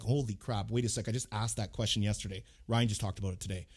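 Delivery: low, soft imagined student; hushed mock realization. Imagined student recognizing their own question, hushed realization. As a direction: drop down softly, as if realizing it privately. Voices amazed course students' reaction.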